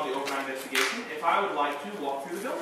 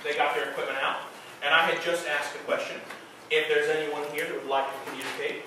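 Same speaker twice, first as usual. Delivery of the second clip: ghost tour guide; 4 semitones higher, 7 dB louder than usual. Acted. Guide tells ghost story.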